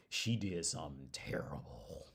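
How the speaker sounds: hushed, slow conspiratorial whisper. Real, not acted. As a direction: lean in and whisper it like a secret. Confiding gossip about Amber Heard.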